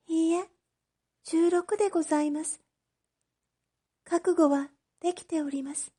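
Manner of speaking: put-on a girl at peace with her sentence; resigned young girl. Oshichi telling the magistrate she is ready, high and soft.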